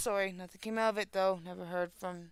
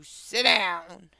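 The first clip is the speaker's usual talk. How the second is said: louder, retold bus driver's yell; moderately raised retold shout; mock yell; 11 dB louder than usual. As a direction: call it out as if over noisy kids. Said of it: "I yelled over the sound".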